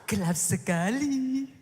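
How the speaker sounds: mock God as a complainer; mock gasp. Preacher mocks God complaining, hammed-up gasp. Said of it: "Apa Tuhan tambahin ngomongnya gini".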